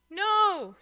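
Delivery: sharp denial, loud and high; shout. A narrator's reading. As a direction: snap the no out in a shout. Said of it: "Tuffy shouted".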